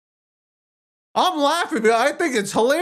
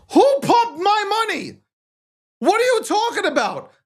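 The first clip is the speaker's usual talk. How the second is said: who, me? shocked and offended; indignant disbelief; 9 semitones higher than usual. Real, not acted. Reacting to accusation in post.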